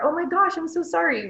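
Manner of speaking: put-on high, loud, sorry gasp to a child; dismayed apology. A parent apologises to a pinched toddler, high and dismayed. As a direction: apologise to a hurt toddler, all concern.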